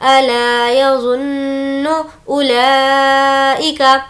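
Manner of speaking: slow tajweed recitation of the verse. Shown as a demonstration. Teacher reciting the verse for students. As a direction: recite it drawn out and melodic.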